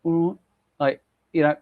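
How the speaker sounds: "Mm" slurred into a lazy Australian sound; a hint of slovenly Australian, half-hearted; slowed Australian drawl. Telling chat how the accent slowed down, a mild Australian mumble. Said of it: "an Australian accent where it's a bit more slovenly".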